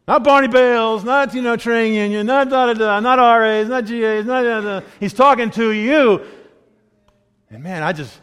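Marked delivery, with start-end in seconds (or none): 0.1-6.2 high, loud, driving list; imitated pulpit harangue; preacher's insistent tirade. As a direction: rattle off the list like a fired-up preacher. Retold preacher singles him out, listing others.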